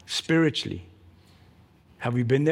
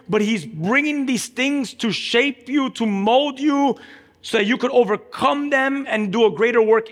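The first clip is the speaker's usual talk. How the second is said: preaching; 8 semitones higher, 4 dB louder than usual. Acted. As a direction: press each phrase home to the congregation. Preaching to the congregation.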